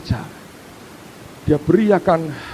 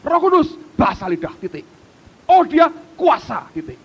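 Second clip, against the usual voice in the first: high, curt mock voice; dismissive believer; 9 semitones higher than usual. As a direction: close the topic like a know-it-all. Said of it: "Sebagian besar orang Kristen".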